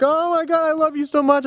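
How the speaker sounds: very high pet-talk voice; retold overjoyed doting; gushing affection. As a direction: coo adoringly at your dog, way up high. Gushing to his dog, overjoyed.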